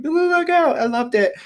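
very high, loud squeal of delight; gushing squeal. Retold or imitated. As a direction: gush in a sky-high cutesy voice. Retold gushing over a gift.

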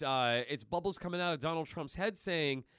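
normal talking voice

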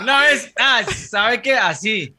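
laughing as you finally spit it out; giggly confession. Real, not acted. Giggling as he starts his embarrassing confession to his friend.

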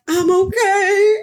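high, loud, drawn-out reply; strained reassurance. Retold or imitated. Calling through the bathroom door, strained high reassurance. Answering a knock while sick.

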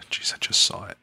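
half-whispered muttering while searching; whispering to self. Real, not acted. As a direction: mutter it under the breath. Searching for a number while talking.

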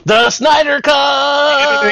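slow, high hyped shout; excited shout. Acted. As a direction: belt the title out slowly, hyped. Hyped shout of movie title.

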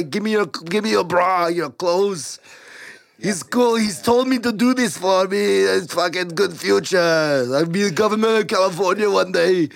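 goofy Austrian impression; Arnold. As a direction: play the Austrian star for laughs. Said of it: "obsessed with Arnold talking to their moms".

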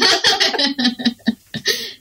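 loud high laugh; real burst of laughter. Real, not acted. Laughs at the story.

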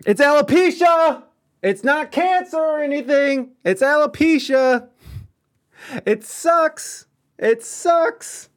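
high, loud, fed-up exclamation; loud high-pitched exasperation; exasperated outcry. Acted. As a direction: throw it out like you can't believe this. Exasperated outburst about the joke.

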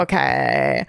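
slow deep creaky voice; vocal fry. Shown as a demonstration. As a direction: drag it out in a low creak. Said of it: "It's the frogging".

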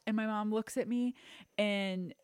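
ordinary talk, nothing marked

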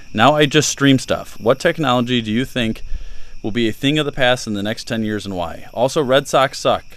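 loud, lifted read-out voice; reading a listener letter aloud. Real, not acted. Reads a listener question aloud.

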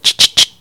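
voiceless mock shotgun pump click; shotgun pump noise. Neighbor racking his shotgun at the stranger, voiceless pump click. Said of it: "He literally loaded his gun".

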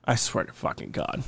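whispered under breath; exasperated whisper. Real, not acted. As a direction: hiss it in frustration. Frustrated at co-host.

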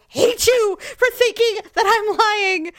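high, slow, tearful mock outrage; hammed-up wounded cry; wailing protest. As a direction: whine it as if near tears. Acted quarrel with doubting husband.

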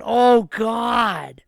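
loud, high cry of disbelief; stunned outburst at the spoiler; shocked exclamation. Retold or imitated. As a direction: blurt it out like the twist just hit you. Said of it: "I said".

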